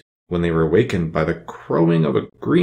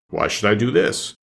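demanding beast. Acted. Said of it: "demanded the beast".

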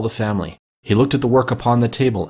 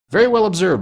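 higher, pleased exclamation; approving cry; 10 semitones higher than usual. A narrator's reading. Father praising his son Peter, approving cry. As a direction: exclaim your approval, pitch lifted. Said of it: "cried Bob".

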